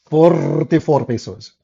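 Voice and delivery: "Forty-four pesos" slow, in a Filipino accent. Shown as a demonstration. Showing listeners the Filipino way of saying forty-four, slow accent. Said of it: "the Filipino way is better to say it".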